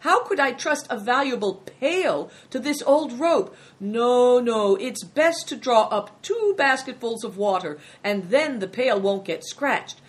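emphatic, over-polite refusal; fussy innkeeper. A narrator's reading. Innkeeper fussily refuses the pail.

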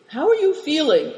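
high anxious question; anxious check on the boy. A narrator's reading. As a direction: ask anxiously. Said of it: "inquired Moonlight anxiously".

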